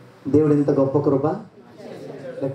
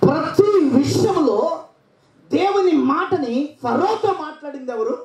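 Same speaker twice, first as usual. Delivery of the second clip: high, slow, laughing challenge; rousing question; 12 semitones higher, slower than usual. Real, not acted. Preacher challenging the congregation to shout the answer, high and slow. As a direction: stir the crowd to answer.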